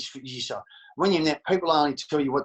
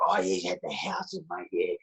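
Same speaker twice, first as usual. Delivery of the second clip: lower, offhand mock voice; mocking gossipers; 8 semitones lower, 4 dB quieter than usual. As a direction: brush it off like idle gossip. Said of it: "They say".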